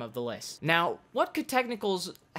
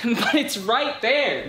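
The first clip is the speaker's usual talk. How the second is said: laughing; 6 semitones higher, 8 dB louder, slower than usual. Acted. Laughing at the temptation.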